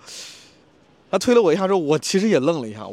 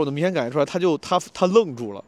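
ordinary talk, nothing marked

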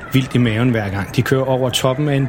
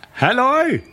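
calling out; 13 semitones higher than usual. Acted. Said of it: "som råber til dem".

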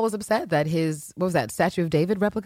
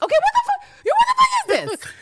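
much higher, louder outburst; mock shout; 5 dB louder, faster than usual. Carolyn loses her patience with everyone, shouting. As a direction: explode at the chaos in the room. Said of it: "Carolyn is like".

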